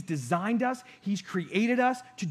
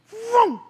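loud, high imitated engine roar; car vroom; 8 dB louder than usual. Describing cars racing past, loud high vroom noise. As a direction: make the cars whoosh by.

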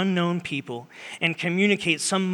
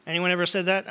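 ordinary talk, nothing marked

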